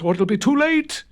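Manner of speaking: high, loud, frantic ghost cry; urgent cry. A narrator's reading. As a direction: cry out in a panic. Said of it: "cried the ghost".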